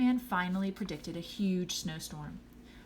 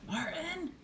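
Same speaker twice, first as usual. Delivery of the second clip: high-pitched mock yell; scolding call, held back in volume; high, exasperated shout of the name; dad's yell; 11 semitones higher, 3 dB quieter than usual. A narrator's reading. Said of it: "yelled his dad".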